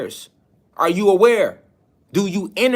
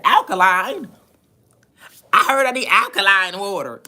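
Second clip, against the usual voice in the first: mock parroting; 3 semitones higher than usual. Lecturer mimics people repeating alkaline, mock parroting. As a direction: parrot it mockingly, like a naive follower.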